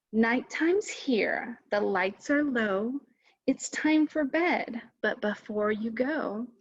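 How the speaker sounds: normal talking voice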